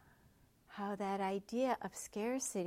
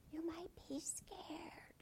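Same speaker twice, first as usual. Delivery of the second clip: breathy whispered, slow and much softer; soft little inner voice; 8 semitones higher, 11 dB quieter, slower than usual. Shown as a demonstration. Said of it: "they're like little voices".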